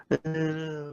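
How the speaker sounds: soft, indistinct mumble; mock too-quiet murmur; imitated quiet customer. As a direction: imitate someone barely audible. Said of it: "she was so fucking quiet".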